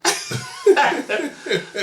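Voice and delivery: high bursting laugh at own story; real laugh. Real, not acted.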